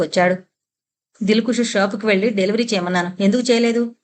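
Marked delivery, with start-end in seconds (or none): none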